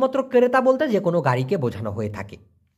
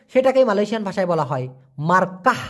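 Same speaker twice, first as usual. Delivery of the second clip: normal talking voice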